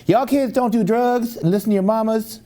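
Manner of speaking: high-pitched mock call to a crowd of kids; Ronnie Coleman. Lifter to a gym full of kids, imitated high call. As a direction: imitate a lifter preaching to kids mid-rep.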